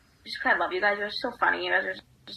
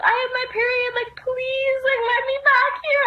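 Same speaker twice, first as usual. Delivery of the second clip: much higher, mock-sobbing plea; crying plea; 4 dB louder than usual. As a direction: beg the guard like you're in tears, way up high. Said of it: "I was, like, crying so hard".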